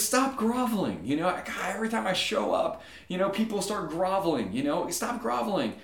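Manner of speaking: high-pitched exasperated complaint; fed-up deity griping at his worshippers; upset God. Acted. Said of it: "he's an upset God who you can't please".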